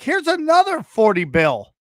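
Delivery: mock investor quote. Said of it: "they're like".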